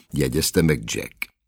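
plain reading voice

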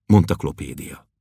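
usual speaking voice

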